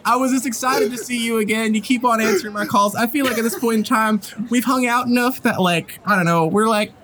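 high, rambling, awkwardly friendly voice; earnest employer character. Acted. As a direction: confess fondness for a work friend, haltingly. Game master voices Ramira.

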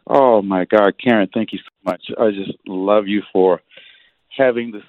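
thrilled caller gushing at full volume; overjoyed fan. Real, not acted. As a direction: gush at her idol, nearly shouting. Caller thrilled to reach her idol.

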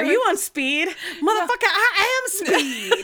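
loud, laughing, over-the-top boast; hammed-up outburst. Friends quoting a favorite show, high mock shout.

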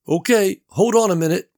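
Gary calling through the wall; Gary's voice. A narrator's reading.

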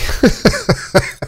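breathy high laugh at himself; a quick airy chuckle at his own expense; light self-mocking laugh; short laugh. Real, not acted.

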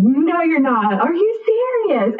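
high, drawn-out incredulous reply; retold disbelief. Boyfriend told she's pregnant, high incredulous disbelief. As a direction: imitate his giddy refusal to believe it.

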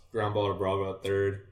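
ordinary delivery

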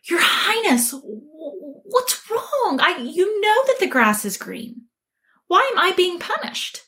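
high-pitched, stammering protest; flabbergasted tiger. Acted. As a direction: plead with the king in baffled disbelief. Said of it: "And the tiger is flabbergasted".

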